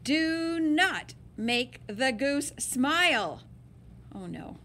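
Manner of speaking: plain reading voice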